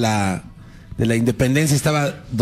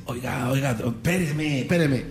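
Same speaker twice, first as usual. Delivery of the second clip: soft, hesitant aside; discreet interruption; 5 dB quieter than usual. Acted. Doña Josefa approaching Hidalgo mid-speech, soft interrupting aside. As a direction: try to cut in softly. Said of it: "se le acerca y le dice".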